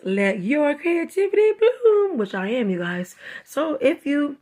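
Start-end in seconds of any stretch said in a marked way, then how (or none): 0.0-2.2 read-aloud slogan. Shown as a demonstration. Reading the coloring book slogan.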